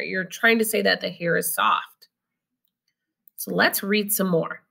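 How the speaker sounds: normal talking voice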